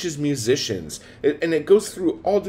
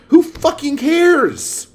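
exasperated yell; 13 semitones higher, 6 dB louder, slower than usual. Real, not acted. Ranting about upset readers.

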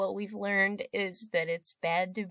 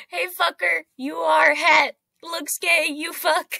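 loud, higher mock insult; raised-voice, over-the-top jeering; hammed-up heckler; 6 semitones higher, 7 dB louder than usual. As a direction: shout the taunt like a bad actor. Performing the scripted heckler on cue.

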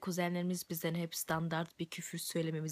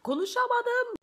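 pitched way up and loud; high squeaky voice; 7 dB louder than usual. Acted. (ince, yüksek ses)

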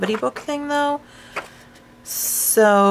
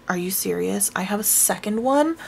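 ordinary delivery

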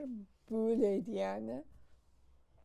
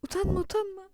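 loud, high encouraging cry; cheering on; 7 semitones higher, 7 dB louder than usual. Real, not acted. Mother loudly cheers on her shy baby. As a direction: cheer him out of his shyness.

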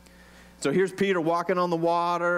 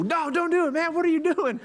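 high-pitched mock-frantic pleading; hammed-up alarm, voice pitched way up; panicked guy in the boat; 11 semitones higher than usual. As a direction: plead like you're hauling a friend back by his coat. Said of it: "I would be the guy in the boat grabbing Peter's coat and go".

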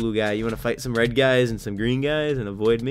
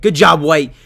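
much louder cheer; loud praise; 11 dB louder than usual. Real, not acted. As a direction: call it out loudly.

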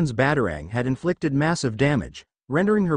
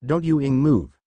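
growled. A narrator's reading. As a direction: growl it as a warning. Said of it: "Louise growled".

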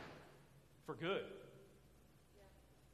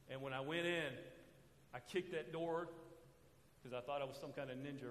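ordinary talk

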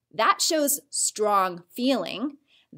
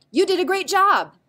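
bright, lifted and louder; excited praise; 8 semitones higher, 5 dB louder than usual. Shown as a demonstration. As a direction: cheer the student on. Teacher voicing an enthusiastic example.

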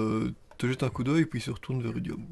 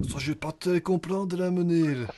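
put-on Flemish accent, higher pitch; 5 semitones higher than usual. Nain flamand répond au prêtre, faux accent flamand. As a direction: play a dwarf with a mock Flemish accent. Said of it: "Je vais faire totalement flamand".

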